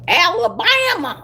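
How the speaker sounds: exaggerated Southern twang, pitched high; country Alabama accent. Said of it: "there's some country in Alabama".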